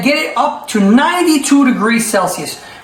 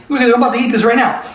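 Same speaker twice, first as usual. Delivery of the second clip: ordinary talk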